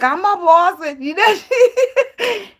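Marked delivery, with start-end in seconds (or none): 0.0-0.8 higher, louder boast, cracking up; boastful reality star. Retold or imitated. Imitating the reality star bragging, laughing. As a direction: brag like a self-declared boss. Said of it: "And she was like".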